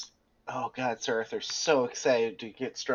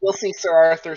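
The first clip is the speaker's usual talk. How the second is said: excited; 3 semitones higher, 7 dB louder than usual. Acted. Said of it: "giddy like a child".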